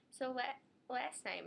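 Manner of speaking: normal talking voice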